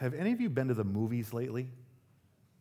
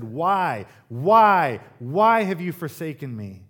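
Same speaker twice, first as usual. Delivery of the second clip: rising, much louder cry of why; anguished cry; 4 semitones higher, 10 dB louder, slower than usual. Acted. Preacher voices Jesus crying out.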